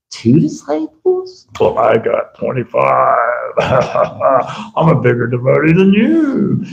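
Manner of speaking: drawn-out, exaggerated one-upmanship; hammed-up bragging with a gloating laugh; mock boasting. Devotee brags to a rival about disciples, mock boasting.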